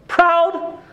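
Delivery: high, stunned disbelieving rise; incredulous question. Real, not acted. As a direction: throw the word back in shock. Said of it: "didn't even know how to respond".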